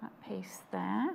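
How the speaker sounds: very soft, half-whispered mutter to oneself. Real, not acted. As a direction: murmur it under your breath while working. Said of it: "What are you muttering about now?".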